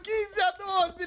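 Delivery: slow, high, gloating laugh through the score. Real, not acted. Streamer gloats over the score lead to Liminha, laughing. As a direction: savor it with a chuckle.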